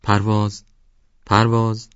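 calls the name into the mountain mist; a loud call across the slope. Acted. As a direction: shout the name upward toward the peak. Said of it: "فریاد زد".

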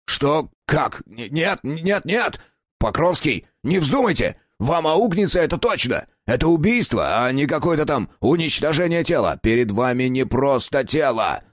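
high, frantic protest; desperate protest. Acted. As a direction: fight for your life. Patient protests his killing.

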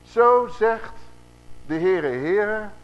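slow, loud, drawn-out "Zo zegt de Heere, Heere"; emphatic recitation. Shown as a demonstration. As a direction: hammer out "Zo zegt de Heere, Heere" syllable by syllable. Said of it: "Dit staat er in de grondtaal".

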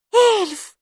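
put-on very high child's exclamation; delighted little girl. A narrator's reading. Maiki hears there may be an elf, high delighted cry. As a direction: squeak it out with childlike joy. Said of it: "обрадовалась Майки".

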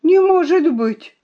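high, loud cry of disbelief; shocked exclamation. A narrator's reading. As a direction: exclaim it, rising sharply in volume. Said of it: "воскликнула я".

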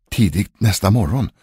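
plain reading voice

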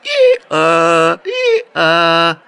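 loud braying hee-haw; donkey bray. A narrator's reading. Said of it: "выразительный ослиный рёв".

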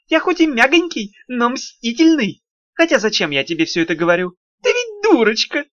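high, sugary imp voice from the pipe; mocking, fussy little demon; imp gurgling from drain. Тухламон булькает из раковины. (писклявый вкрадчивый голосок беса из слива)

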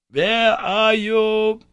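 loud, slow call across a distance. Acted. Uncle calling to his lost nephew, a loud slow call. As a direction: call out slowly to someone far away. Uncle calls through rock.

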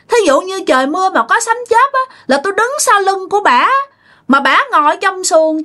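high-pitched voice of a defiant ghost; cocky spirit, boastful. Retold or imitated. Spirit tells the exorcist thunder cannot strike it, high and cocky. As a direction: brag in a high, insistent spirit voice.

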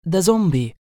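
high, loud, eager announcement; full of enthusiasm. A narrator's reading. Singer eagerly introducing her zombie to the director. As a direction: proudly present your find. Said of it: "بلهجة مليئة بالحماس".

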